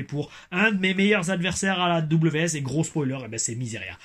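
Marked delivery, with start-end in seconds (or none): none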